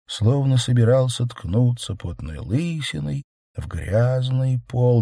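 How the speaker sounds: ordinary delivery, nothing marked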